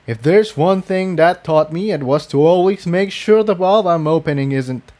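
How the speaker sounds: rueful Jigsaw. Acted. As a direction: deliver the punchline wryly. Jigsaw finishes the tale.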